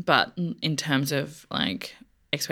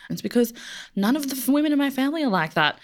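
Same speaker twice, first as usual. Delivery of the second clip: usual speaking voice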